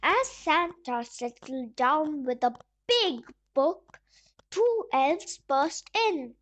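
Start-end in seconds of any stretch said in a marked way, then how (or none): none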